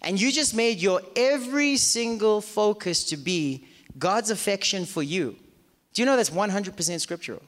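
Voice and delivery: ordinary talk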